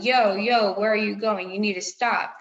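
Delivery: a hallway holler, half-acted; mock yell. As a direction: imitate a teacher yelling down the hall. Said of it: "I just hear someone yelling".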